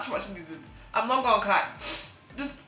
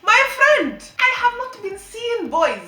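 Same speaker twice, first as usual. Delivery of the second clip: exasperated outcry; 11 semitones higher, 10 dB louder, slower than usual. Real, not acted. Exasperated outburst to viewers.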